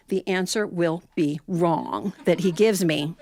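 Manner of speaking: plain reading voice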